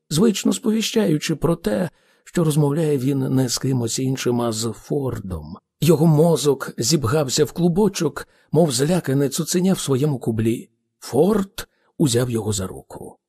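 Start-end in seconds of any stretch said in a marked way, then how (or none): none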